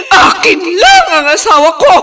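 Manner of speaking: loud, tearful claim; cling to him with your voice; possessive cry. Acted. Wife fears losing her husband. (umiiyak na sigaw ng pag-angkin)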